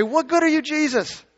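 high-pitched frustrated complaint at Jesus; angry, frustrated. Acted. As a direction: throw it out as an exasperated, accusing question. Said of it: "you're gonna get angry, frustrated".

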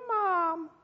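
put-on high, soft, drawn-out child's call; hurt child. Hurt child on the ground, calling weakly for mom. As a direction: call faintly like a hurt little kid.

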